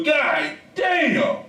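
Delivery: half-laughing exasperation; slow frustrated exclamation. Real, not acted. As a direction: stretch the curse out in exasperation.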